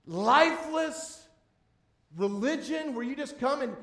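a pastor rails against empty religion to his congregation; preaching at full force. Acted. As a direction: rail against lifeless religion. Preacher denouncing dead religion.

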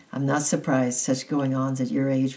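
ordinary delivery, nothing marked

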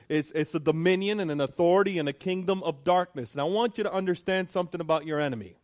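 fervent preaching. Acted. Preaching rises in force.

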